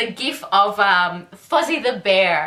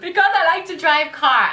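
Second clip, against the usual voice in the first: laughing, mock German accent, a bit higher; 7 semitones higher, 3 dB louder than usual. Mocking her date's reply in a German accent. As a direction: quote his line in a jokey German accent. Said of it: "really strange German accent".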